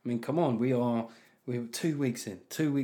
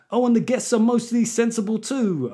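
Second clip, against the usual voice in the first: high put-on garage MC voice; hammed-up garage accent on a mild line; 7 semitones higher, 4 dB louder than usual. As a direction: call it out like a mock garage MC. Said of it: "that's where the garage voice just doesn't work".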